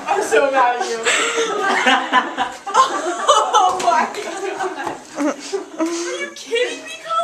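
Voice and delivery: high, loud, laughing protest; mock outrage through laughter. Real, not acted. Reacting to the prom invitation.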